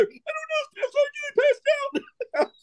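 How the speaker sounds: tiny, very high falsetto excuse; squeaky Marine. Retold or imitated. Fainted Marine pleading with staff sergeant, squeaky falsetto caricature. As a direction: squeak it out like a caricature of a helpless recruit.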